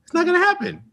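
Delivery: high, fast incredulous protest; mock outrage. Telling friend about Saturday tutoring, high mock outrage. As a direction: squeal it like the idea is absurd.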